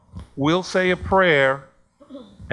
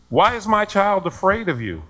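high-pitched mock accusation; imitated angry parent; 6 semitones higher, 4 dB louder than usual. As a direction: confront them as an irate parent would. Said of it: "why are you so angry?".

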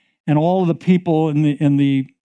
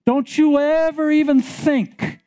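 much higher fervent warning; high insistence; 11 semitones higher than usual. Acted. Preacher warning the congregation, high and fervent. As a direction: push up high, urging.

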